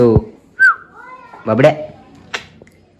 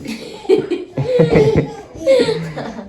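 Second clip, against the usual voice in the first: high burst of laughter. Real, not acted. Laughing after guessing the child's number, high giggle.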